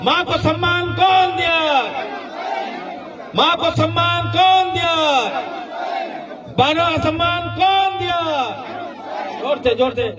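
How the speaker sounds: high, ringing chant with every कौन stretched; rally call-and-response. Acted. Politician rouses the rally crowd to answer, chanting. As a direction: whip up the crowd line by line.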